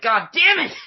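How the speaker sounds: high, loud outburst of frustration; hammed-up agonised curse; mock exasperated yell. Moviegoer reacts to the love theme, exasperated yell.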